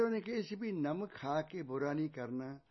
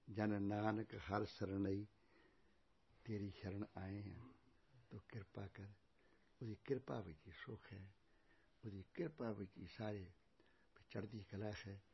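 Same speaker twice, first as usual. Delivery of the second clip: low, soft devotional surrender; hushed prayer; 5 semitones lower, 8 dB quieter than usual. Real, not acted. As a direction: pray quietly, pausing between phrases. Closing prayer. (ਧੀਮੀ ਆਵਾਜ਼ 'ਚ ਅਰਦਾਸ)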